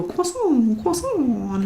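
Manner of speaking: French-style croissant echoed twice. Retold or imitated. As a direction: imitate French viewers saying croissant, croissant. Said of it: "it's funny the way that they say it".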